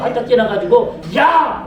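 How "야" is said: short shouted hail at a robot; mock shout. Preacher testing a robot by yelling at it, mock shout. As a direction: call out abruptly to startle it. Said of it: "소리쳤더니".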